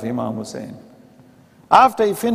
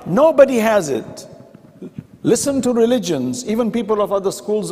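fervent preaching. Acted. Preaching at full force.

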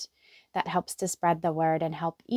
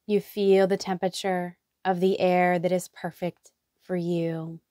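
guided meditation. Acted. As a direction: lead them gently into relaxation, letting each phrase settle. Leads a guided hypnotherapy meditation.

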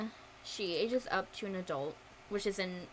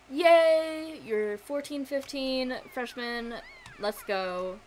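mock cheer; 3 semitones higher, 7 dB louder, much slower than usual. Mock cheer for teen makeover.

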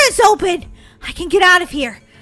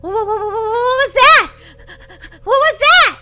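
frightened stammer; 4 semitones higher, 4 dB louder than usual. Pretend. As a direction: stutter into a high, loud question. Hears wolf, scared.

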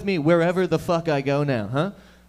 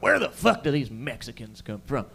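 loud, higher shouting Texan; angry Texan; 5 semitones higher, 5 dB louder than usual. Retold or imitated. Texans ranting about Mexicans, loud angry Texan. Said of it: "They say, like".

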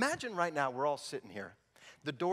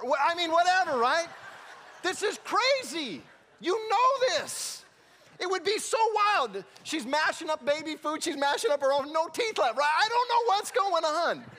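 much higher and louder, laughing disbelief; high, loud, amused exclamations; incredulous excitement; 13 semitones higher, 6 dB louder than usual. Real, not acted. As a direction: marvel at how wild it is. Preacher laughing at absurd scene.

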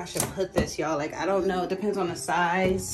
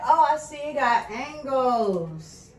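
excited; 9 semitones higher, slower than usual. Real, not acted. Trying out new shower head.

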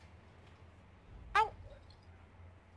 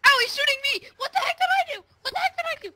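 high, panicked protest; shocked outcry; 11 semitones higher, faster than usual. Real, not acted. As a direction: cry out in disbelief. Being shot in game.

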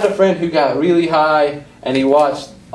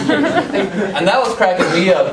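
slow words through laughter; chuckling as you speak; laughing; 3 semitones higher, slower than usual. Real, not acted. Laughing while telling it.

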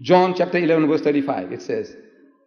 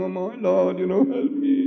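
high, climbing tearful plea; crying to himself; 4 semitones higher than usual. Retold or imitated. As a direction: imitate a private, weepy prayer. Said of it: "he was crying to himself".

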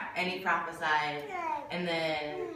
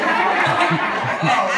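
big open laugh, louder; loud burst of laughter; 10 dB louder than usual. Real, not acted. Telling the church about work, bursting into loud laughter. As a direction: let a full laugh burst out.

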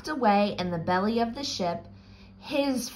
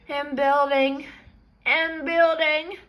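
rising higher each time; building tension; 6 semitones higher than usual. A narrator's reading. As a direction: climb in pitch with each building. Gas builds up.

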